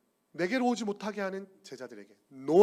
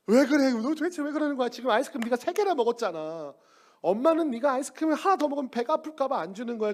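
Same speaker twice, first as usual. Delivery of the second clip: exasperated mother; 6 semitones higher than usual. Acted. Mother scolding child.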